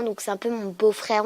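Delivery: ordinary talk, nothing marked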